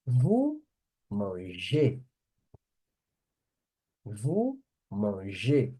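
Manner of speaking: "Vous mangez" slowly, word by word; French pronunciation demo. Shown as a demonstration. Teacher showing the form that changes, deliberate French demo. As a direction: sound out "mangez" with its final "-ay". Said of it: "A pronúncia só muda no vós".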